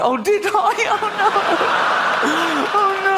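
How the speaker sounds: very high, hammed-up wail; mock distraught child. Niece told she rolled on her puppy, mock wail. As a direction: imitate a horrified child, squealing high.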